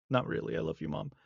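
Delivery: ordinary delivery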